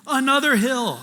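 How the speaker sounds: loud, high exasperated laugh-cry of another hill; exasperated disbelief. Retold or imitated. Preacher to congregation, retelling the trail, exasperated laughing outburst.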